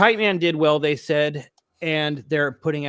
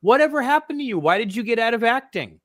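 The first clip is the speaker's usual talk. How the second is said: high, mocking fan question; 8 semitones higher than usual. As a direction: ask it like a clueless fan. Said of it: "randomly and said".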